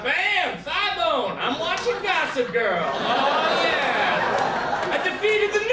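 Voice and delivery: loud, high victory whoop; mock triumph. Viewer gloats at beating the news.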